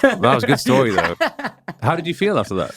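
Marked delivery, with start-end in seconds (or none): 0.0-1.2 much higher and louder through a laugh; cracking up while praising. Real, not acted. Host laughing at the story.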